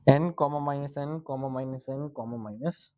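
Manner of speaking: slow whispered reading of the options; teacher whispering through option C. Real, not acted. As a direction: mouth the choices to yourself. Reading options under breath.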